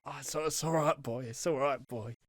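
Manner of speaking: a touch of tearful comfort; soft, understated near-tears reassurance; nearly crying. Retold or imitated. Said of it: "nearly crying".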